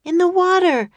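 high, loud urgent answer; calling out. A narrator's reading. Annie calls out what she spotted.

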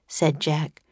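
usual speaking voice

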